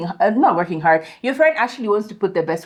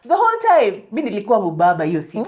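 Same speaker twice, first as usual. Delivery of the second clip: ordinary talk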